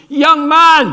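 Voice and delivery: put-on a preacher shouting at a young man in the pews; preacher's shout. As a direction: bellow it like a preacher singling someone out. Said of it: "he just shouted".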